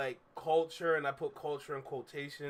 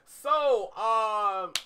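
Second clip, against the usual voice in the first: loud; 5 semitones higher, 8 dB louder than usual. Real, not acted. After laughing loudly.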